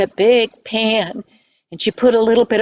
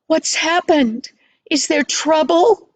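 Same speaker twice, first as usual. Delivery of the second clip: higher, anxious questioning; mildly alarmed mother; 6 semitones higher than usual. Acted. Mother asking late visitors about trouble, mildly alarmed. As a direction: ask with a touch of alarm, pitched up.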